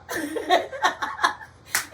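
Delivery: high, loud laughing fit; burst of laughter. Real, not acted. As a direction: crack up at the memory. Laughing about her old car.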